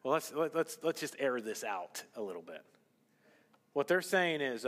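ordinary delivery

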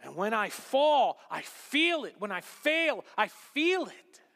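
loud, high, impassioned plea; slow-opening, fervent preacher's outpouring; emotional declaration. Acted. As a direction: cry out devotion with full conviction. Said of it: "an emotional response of".

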